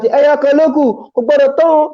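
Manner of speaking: high excited crowd shout; high crowd yell. Retold or imitated. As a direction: yell it like buyers rushing a stall. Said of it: "We shout".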